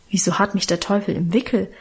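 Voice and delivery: usual speaking voice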